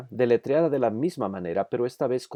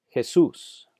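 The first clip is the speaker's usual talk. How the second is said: Jesús with "sús" punched higher and louder; stress on accented syllable; 4 semitones higher, 5 dB louder than usual. Shown as a demonstration. Teacher showing words with tilde, Jesús stressed on "sús". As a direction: hit the last syllable of Jesús. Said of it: "sílaba que necesita ser enfatizada".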